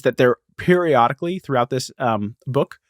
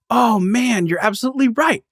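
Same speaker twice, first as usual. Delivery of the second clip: high-pitched, apologetic mock realization; hammed-up sheepish admission; 10 semitones higher than usual. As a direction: own up to it, voice pitched way up. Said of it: "the guy's kinda sheepish".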